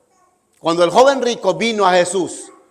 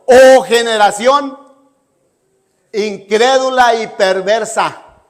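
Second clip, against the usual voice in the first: stern rebuke; 6 dB louder, slower than usual. Retold or imitated. Jesus rebukes the disciples.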